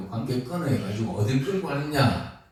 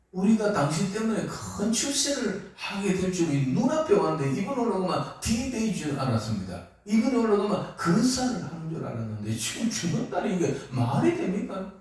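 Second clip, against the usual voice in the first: lightly voiced, higher whining disciple; a hint of a let-down follower's whine; Peter complaining; 6 semitones higher than usual. (예수님께 따지는 베드로, 살짝 높은 푸념조)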